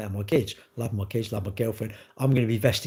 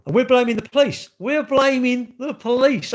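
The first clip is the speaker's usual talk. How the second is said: raised, loud, exasperated protest; high and emphatic, slowing on the repeat; indignant disbelief; 11 semitones higher, 6 dB louder than usual. Real, not acted. Ranting at people who blame the police, indignant.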